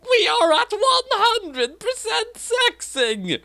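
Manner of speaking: very high, slow yelping voice; yipping. A narrator's reading. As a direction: yelp it out high. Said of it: "Misa said between scary yips".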